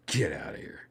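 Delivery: whispered disbelief. Real, not acted. Reacting to the fall, whispering in disbelief. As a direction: breathe it in awe.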